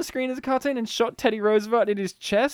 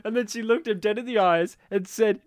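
normal talking voice

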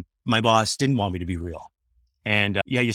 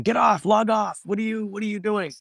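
mock angry boss; 10 semitones higher than usual. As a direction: play the furious boss yelling down the phone. Boss blowing up his phone.

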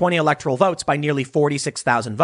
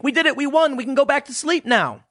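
higher, smug mocking voice; mock smug leftists; 9 semitones higher than usual. Mocking leftists who think they won. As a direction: lightly mimic gloating leftists. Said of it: "leftists just think".

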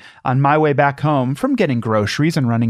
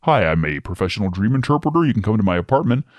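lower, smooth creepy pitch; creepy stranger voice; 4 semitones lower than usual. Pretend. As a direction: sell it like a creepy salesman. Acting the dream interpreter.

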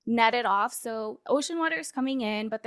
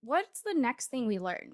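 ordinary delivery

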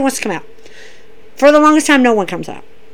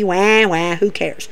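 mock baby crying; 5 semitones lower, 6 dB quieter, slower than usual. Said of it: "Big baby".

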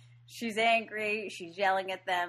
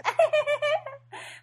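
mock giggle; 4 dB louder than usual. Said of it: "giggling with each other".

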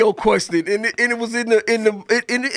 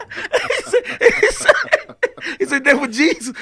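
laughing hard; 12 semitones higher than usual. Real, not acted. Laughing retelling.

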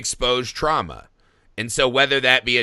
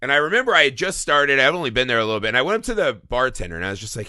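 ordinary delivery

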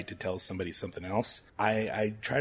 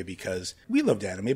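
normal talking voice

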